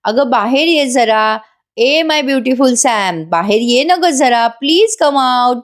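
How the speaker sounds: lilting, slow mock call with stretched endings; Sachin. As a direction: call a sweetheart out of another room, playfully imitated. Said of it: "साक्षीला आवाज देत असतो".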